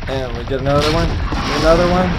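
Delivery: tense gamer cheering; excited, high and loud. Real, not acted. Tense shooting then success.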